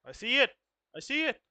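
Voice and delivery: loud, high burst of realization; excited exclamation. Real, not acted. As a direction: shout it out as it clicks. Sudden realization at screen.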